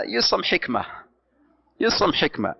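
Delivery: ordinary delivery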